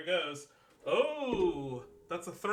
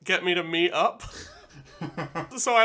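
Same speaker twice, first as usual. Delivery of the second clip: usual speaking voice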